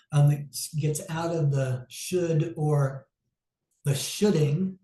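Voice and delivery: ordinary delivery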